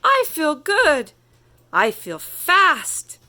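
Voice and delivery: put-on slow, loud old-tortoise voice; slow, pleased Tortoise. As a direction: savor each word like an old tortoise. Tortoise speaks on a fine day.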